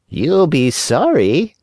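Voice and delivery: high, slow cat echo. Acted. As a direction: parrot the fox like a cat. Cat echoes the fox.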